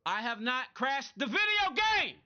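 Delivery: exasperated protest at a chatter; loud insistence. Real, not acted. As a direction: set the viewer straight, loudly and slowly. Insists to chat he did not crash.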